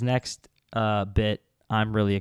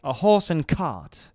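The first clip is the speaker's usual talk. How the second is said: put-on posh English accent; 4 semitones higher, slower than usual. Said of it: "English ones that are, like, very fancy".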